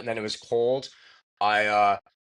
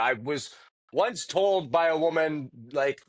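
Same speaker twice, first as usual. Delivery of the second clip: normal talking voice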